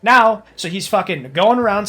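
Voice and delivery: ordinary delivery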